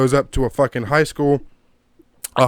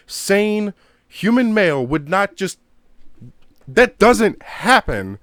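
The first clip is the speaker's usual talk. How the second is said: high-pitched, exasperated disbelief; incredulous protest; 7 semitones higher, slower than usual. Real, not acted. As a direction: argue it in a raised, baffled pitch. Outraged rant about a movie scene.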